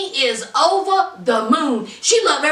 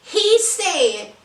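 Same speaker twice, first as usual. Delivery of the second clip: ordinary talk, nothing marked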